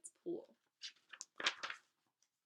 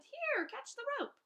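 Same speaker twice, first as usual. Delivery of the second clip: high, louder call down from up high; shouted from above; 4 dB louder than usual. A narrator's reading. A rescuer high above shouts down to the floating boy, high and loud. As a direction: call down to someone stranded below. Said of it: "someone high shouted".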